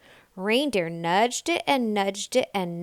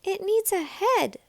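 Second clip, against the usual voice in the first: much higher eager call; cried out; 11 semitones higher than usual. A narrator's reading. Little Nell cries out to the animals. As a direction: call out the idea. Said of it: "cried little Nell".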